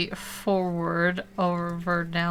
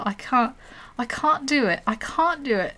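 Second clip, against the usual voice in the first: British accent; 5 semitones higher than usual. Shown as a demonstration. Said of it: "the British accent's easier to mimic".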